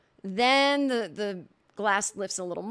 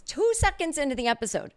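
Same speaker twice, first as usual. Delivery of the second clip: usual speaking voice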